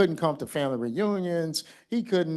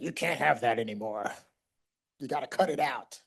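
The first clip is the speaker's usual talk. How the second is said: lower, put-on scolding voice; mock stern doctor; 3 semitones lower than usual. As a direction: lay down the law in mock seriousness. Acting the strict doctor he won't be.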